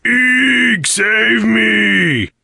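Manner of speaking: high, loud mocking screech. A narrator's reading. Karg mocking Nick's fright, high screech. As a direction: shriek like a scared coward. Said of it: "Karg screeched".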